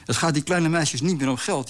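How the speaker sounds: high, lilting jubilation; jubilant cheering. A narrator's reading. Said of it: "juichte Gonzales". (juichend, met hoge stem)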